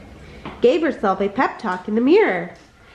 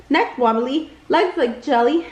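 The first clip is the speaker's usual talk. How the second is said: ordinary delivery, nothing marked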